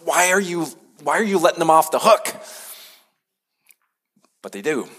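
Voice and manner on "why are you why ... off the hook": higher, indignant complaint to God, lightly acted; mock furious Jonah. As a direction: play Jonah as half-hearted outrage. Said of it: "And Jonah's furious".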